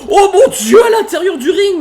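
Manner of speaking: sudden high, loud cry of amazement; excited outburst. Real, not acted. Commentator thrilled by a fight in the ring, excited shout. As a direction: blurt it out as the move lands.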